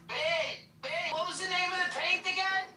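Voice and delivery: calling out. Real, not acted. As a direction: call across the house to your partner. Calling to partner in another room.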